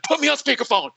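high-pitched mock yell; retold scream. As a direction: imitate a boss yelling down the phone, pitch jumping up. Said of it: "like, screaming".

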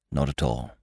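absent, understated reassurance; meaningless politeness. A narrator's reading. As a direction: murmur a polite nothing. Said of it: "said Parker meaninglessly".

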